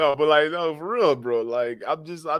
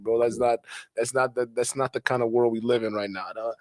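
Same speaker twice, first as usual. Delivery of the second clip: ordinary delivery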